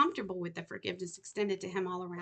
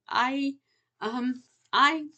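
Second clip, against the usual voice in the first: loud halting stammer; 5 semitones higher, 9 dB louder, much slower than usual. Acted. Danny stammers before confessing.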